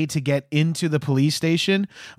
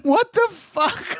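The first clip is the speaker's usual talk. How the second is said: much higher, slow "what the fuck?"; incredulous; much slower than usual. Retold or imitated. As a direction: stretch it out in shocked disbelief. Reacting to movie line.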